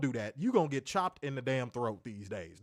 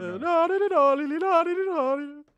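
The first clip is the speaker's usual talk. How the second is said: rapid high squealing no's; high loud no's; 14 semitones higher, 9 dB louder than usual. Real, not acted. Laughing rebuttal of yodel.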